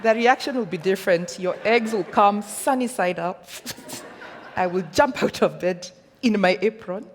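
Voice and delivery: loud, laughing excitement; excited, laughing promise; delighted. Pretend. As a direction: laugh and gush about the reward. Joking about being sent M-Pesa.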